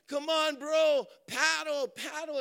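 high, loud urging shout; screaming encouragement. Retold or imitated. Friends scream at the cousin from shore, urgent and high. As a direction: drive him to paddle harder.

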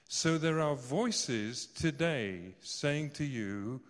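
drawn out to make the congregation listen; slow emphatic preaching. Real, not acted. Preacher slowing down to stress the call.

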